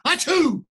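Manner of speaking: loud hammed-up achoo, high and punchy; big fake sneeze. Wolf sneezing at the straw house, loud mock sneeze. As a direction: blast out a huge comic sneeze.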